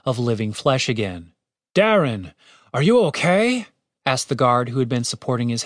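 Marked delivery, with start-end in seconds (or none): 1.7-3.7 worried question. A narrator's reading. Guard worried about healed friend.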